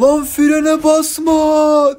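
very high, loud shout with drawn-out vowels; retold warning shout. Storyteller shouting at a skidding driver, drawn-out mock-panicked yell. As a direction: re-enact yelling a long, urgent warning. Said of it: "Bir yandan da bağırıyorum".

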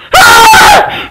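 very high shouted "hey"; anguished cry. Acted. Uncle after the call ends, anguished cry. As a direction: cry out in high-pitched dismay. Man reacts to losing everything.

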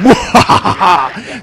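loud gleeful cackle; gleeful laugh. Real, not acted. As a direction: cackle with glee. Laugh after boast.

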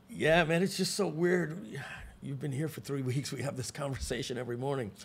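lightly played clerk, trailing into a soft voice; lightly voiced motel guy. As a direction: imitate a motel guy, dropping quiet. Motel guy answering forgetful Lenny.

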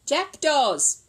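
loud, confident workman's verdict; knowing builder. A narrator's reading. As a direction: announce it like an old hand.

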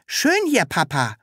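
high, lightly voiced childlike creature; delighted Sams. A narrator's reading. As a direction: chirp it happily.

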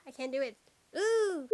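normal talking voice

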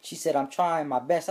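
ordinary talk

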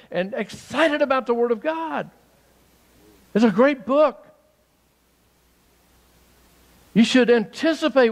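building joyful urgency; excited preaching. Real, not acted. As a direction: lift them up with excitement.

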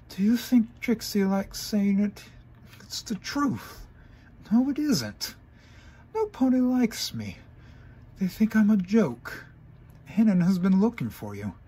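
high, loud upset mare; high distressed pony voice; Trixie's despairing voice. Acted. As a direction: pitch it up in despair.

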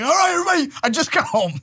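high, pleading mock exasperation; overwhelmed dad trying to get order; exasperated dad. Dad walking in on crying sons, exasperated.

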